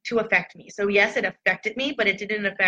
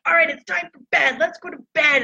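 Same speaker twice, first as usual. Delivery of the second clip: higher, louder mock bedtime order; imitated angry mom yell; 8 semitones higher, 5 dB louder than usual. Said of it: "I would have been screaming and angry".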